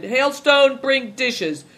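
high, loud call to the kitchen; shouted order. A narrator's reading. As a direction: shout it out like a gruff order to a servant. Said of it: "put the dish on the table and shouted".

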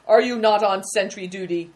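loud, stern officer's reprimand; severe. A narrator's reading. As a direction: take the sentries to task sternly. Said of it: "asked the man severely".

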